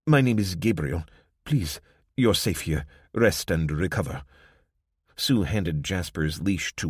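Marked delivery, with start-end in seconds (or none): 0.1-4.2 gentle, lightly voiced African accent; a hint of African accent, soothing strangers; soft African accent. Church greeter welcoming weary travellers in a soft African accent. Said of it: "in a soft African accent".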